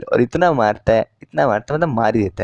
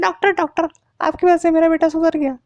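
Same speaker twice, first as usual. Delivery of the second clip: much higher mock female voice; mother's voice. Mother thanking the doctor, high hammed-up falsetto. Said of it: "doctor को बोलते हैं".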